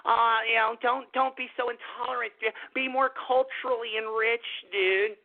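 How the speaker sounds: mock a preachy liberal lecturing you; a hint of a whiny lecturing voice. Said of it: "they wanna be like".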